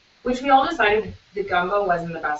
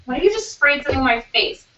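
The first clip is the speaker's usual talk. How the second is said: higher-pitched indignant friend impression; Holly; 4 semitones higher than usual. Teasing impression of friend sprayed in the face, indignant. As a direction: imitate an outraged friend accusing the room. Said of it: "That's a good impression of me".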